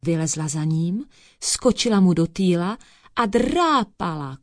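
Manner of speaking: usual speaking voice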